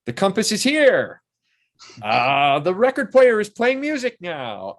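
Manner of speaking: loud, high mock announcement; high, projected imitation of a crew caller; calling out cues. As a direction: shout the cue across the set. Crew member calling out effects cues.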